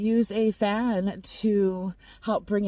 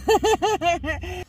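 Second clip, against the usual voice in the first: loud high giggle; high laugh; 12 semitones higher, 8 dB louder than usual. Real, not acted. Laughs at her sign.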